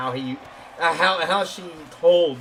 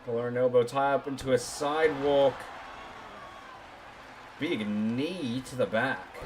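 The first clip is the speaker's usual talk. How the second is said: wrestling commentator; 4 semitones lower, 6 dB quieter than usual. Acted.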